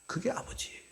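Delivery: 숨소리로만 조용히; 마음에 새기듯 속삭여; 속삭임. Real, not acted. (회중에게 속삭이는 설교자)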